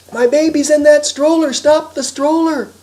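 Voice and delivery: high, loud pleading yell. Acted. Harry yells to the mother raccoon for help, yelling. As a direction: yell it urgently, stretching the end. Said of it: "Harry yelled".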